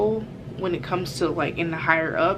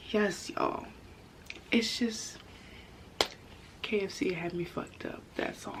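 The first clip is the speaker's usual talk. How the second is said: whispered confession to the viewers; a hushed, wry aside; 2 semitones higher, 11 dB quieter than usual. Real, not acted. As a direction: lean in and whisper it.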